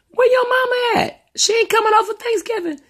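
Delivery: high put-on voice of her son's friend; young man's voice. Mother imitating her son's friend asking about her, high put-on voice. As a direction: pitch it up as the kid asking.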